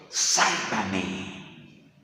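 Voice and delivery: low, drawn-out plea for rescue; retold cry for help, sunk low; Peter's plea. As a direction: draw the plea out, pitched well down. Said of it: "qué le dice Pedro al maestro".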